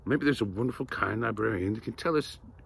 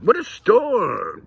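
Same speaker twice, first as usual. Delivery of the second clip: high, drawn-out worried mole; 12 semitones higher, 8 dB louder, slower than usual. A narrator's reading. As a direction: cry it out like a fretful little mole. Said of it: "Mole mutters".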